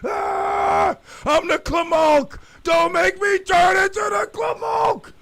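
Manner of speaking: loud, high, panicked shouting; threatening the cop from the back seat, shouting; frantic yelling. Retold or imitated. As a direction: yell like he's about to transform. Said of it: "I'm like".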